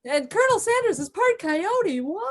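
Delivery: high-pitched melodramatic astonishment; soap opera reveal. Acted. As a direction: play up the shocking plot twist. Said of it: "that was a very soap opera reveal".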